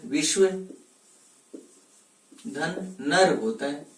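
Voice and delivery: विश्व धन नर spoken slowly, each part apart; split slowly into parts. Shown as a demonstration. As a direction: pause between विश्व and नर. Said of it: "शुद्ध संधि विच्छेद क्या होता है?".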